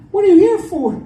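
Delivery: high, prissy front-desk lady voice; prim school receptionist. Retold or imitated. School lady questioning the stepdad, high prim voice.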